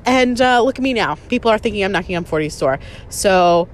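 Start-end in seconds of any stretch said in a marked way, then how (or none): none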